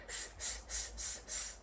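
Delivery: whispered s sounds; monkey hissing. Acted. Monkeys answer the peddler, whispered hissing. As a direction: hiss softly like chattering monkeys. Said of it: "And they said".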